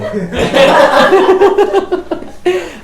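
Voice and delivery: loud high laughter at a student; laughing. Real, not acted. Teacher laughing at a student saying yo, loud laugh. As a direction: crack up at the student.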